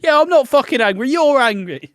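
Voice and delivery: loud, high, defensive yell; hammed-up furious denial, shouted high; acted anger. [name] snapping back at Nigel, acted angry shout. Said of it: "You just get really angry".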